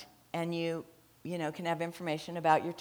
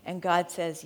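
ordinary delivery

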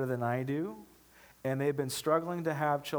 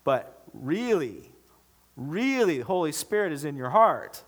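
raised, insistent pulpit voice; emphatic preaching; 6 semitones higher, 7 dB louder than usual. Real, not acted. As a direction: drive the point home to the congregation, high and punchy. Preaching at full force.

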